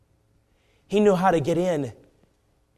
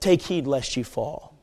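ordinary talk

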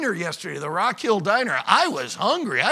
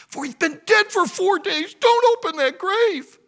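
high, urgent plea to stop; acted sister's outcry, building louder; alarmed protest; 10 semitones higher, 4 dB louder than usual. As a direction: protest in a raised, dramatic voice. Acts Martha protesting to Jesus.

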